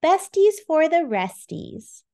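cheerful. Acted. Characters celebrate friendship.

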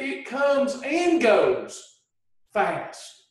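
"it comes and goes fast" said slowly, each word weighted; slow emphatic. Shown as a demonstration. Coach repeats point to class, slow and deliberate. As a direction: lean on it so it sinks in. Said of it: "Notice I said".

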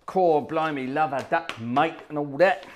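hammed-up cockney geezer; mock London cockney, chirpy; cockney accent. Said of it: "Nobody actually talks like that".

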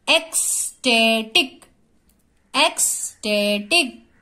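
Ecstatic in three beats with gaps between; syllable by syllable. Shown as a demonstration. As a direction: sound out ecstatic one syllable at a time. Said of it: "एक एक word हमको जोर से बोलना है".

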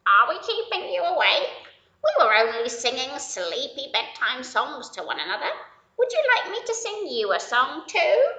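a bright apologetic duck; friendly duck. Acted.

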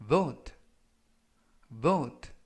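vote said with a v and a long "oh"; pronunciation demo. Shown as a demonstration. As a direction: model vote for learners to repeat. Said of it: "vote라고 발음하시면 되겠습니다". (발음 시범)

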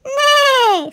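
high, loud, drawn-out neigh; whinny it out, falling at the end; horse whinny. Acted. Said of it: "says the horse".

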